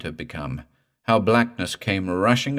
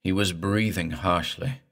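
ordinary delivery

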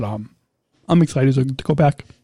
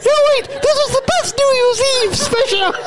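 silly high-pitched host voice; 6 semitones higher than usual. Pretend. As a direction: gleefully tease in a put-on high voice. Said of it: "talk in my high voice".

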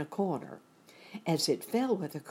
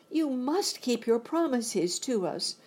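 put-on high, loud girlish insistence; exclaiming; 9 semitones higher, 5 dB louder than usual. A narrator's reading. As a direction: exclaim it as a demand, pitched up. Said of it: "exclaimed Dorothy".

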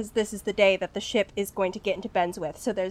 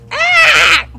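piercing high strangled gull cry; seagull squawk; 13 dB louder than usual. Acted. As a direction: screech like a broken gull. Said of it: "It goes".

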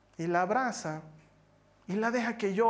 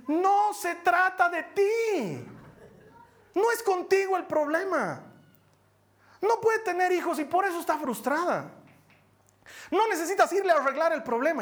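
preaching; 9 semitones higher than usual. Real, not acted. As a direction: drive the point home from the pulpit. Preaching climax to congregation.

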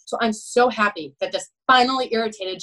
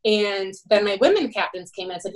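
usual speaking voice

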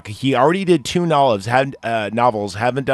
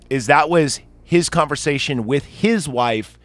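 ordinary talk, nothing marked

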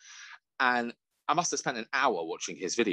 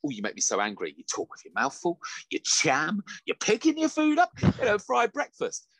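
mock disgust; 5 semitones higher than usual. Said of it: "I'm on TikTok and I'm like".

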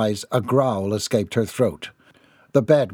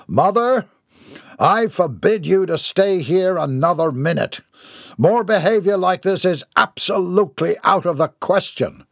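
raised, indignant forbidding tone; stern outrage; 7 semitones higher, 3 dB louder than usual. A narrator's reading. Son ordering his mother out, sternly outraged. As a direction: lay down the law, higher and indignant.

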